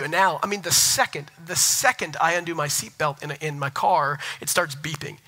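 normal talking voice

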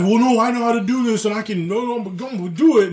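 high, loud mocking impression of a stubborn coworker; hammed-up stubborn guy; mock know-it-all.

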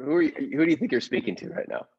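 ordinary delivery

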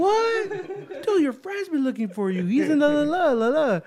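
high, laughing excitement; thrilled to find the lost guy; excited. Retold or imitated. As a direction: burst out with the news, laughing. Excited retelling finding Martin.

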